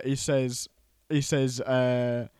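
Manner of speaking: normal talking voice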